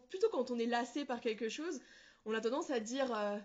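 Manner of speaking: usual speaking voice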